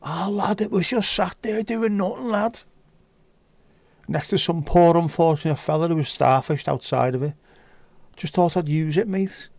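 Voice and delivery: high-pitched put-on Scouse; Scouse accent. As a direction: play it as a high-voiced Scouse lad. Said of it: "with a Scouse accent".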